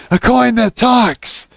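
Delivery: high delighted exclamation; excited squeal. Acted. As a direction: shout it with delight.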